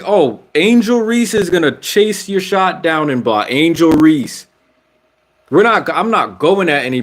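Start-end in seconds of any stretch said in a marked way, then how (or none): 0.0-4.5 high-pitched sarcastic disbelief; incredulous, raised-pitch mock dread; mock alarm. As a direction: voice the player's worry, pitched up and mocking. Mocking the player's fear of a block.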